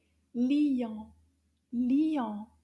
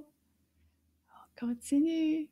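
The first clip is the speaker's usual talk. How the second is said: whisper; 4 semitones higher, much slower than usual. Shown as a demonstration. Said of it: "moving on".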